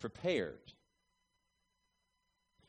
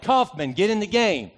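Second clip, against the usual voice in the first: loud call with the name punched; coach yelling; 5 semitones higher, 7 dB louder than usual. Retold or imitated. Coach yelling down the bench at his player, loud. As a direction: yell it down the bench. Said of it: "my coach looked down the bench and yelled".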